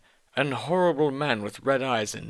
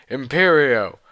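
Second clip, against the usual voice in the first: louder, hammed-up spell cry; shouted curse; 3 semitones higher, 8 dB louder than usual. Voldemort recasting the spell, loud and theatrical. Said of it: "Voldemort shouted".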